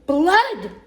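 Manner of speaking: high, loud swooping shout; father's yell. A narrator's reading. Father sees the cut finger, loud hammed-up yell. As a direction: echo the alarm in a bigger yell. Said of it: "Loretta's father yelled".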